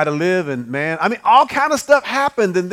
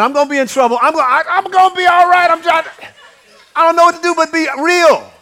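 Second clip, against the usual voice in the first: higher, louder rush of nervous honesty; nervous excitement; 7 semitones higher, 5 dB louder than usual. Real, not acted. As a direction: say it like you're half-afraid of trouble. Nervous about telling the story.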